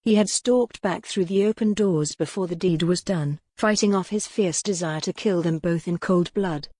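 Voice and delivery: usual speaking voice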